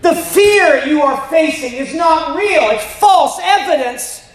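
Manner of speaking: preaching. Acted. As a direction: drive it home to the congregation. Preaching to the congregation.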